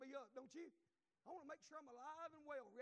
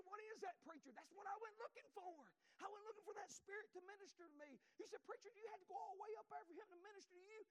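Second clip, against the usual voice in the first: pitched-up revival preaching; high fervent preaching; 7 semitones higher than usual. Acted. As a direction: voice raised high and pressing. Preaching, congregation's question voiced high.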